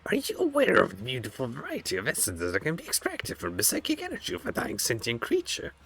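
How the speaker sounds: high, eerie surgeon creature voice; creepy homunculus voice. Acted. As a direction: voice a gloating flesh-crafter, high and strange. Reads homunculus lines in game.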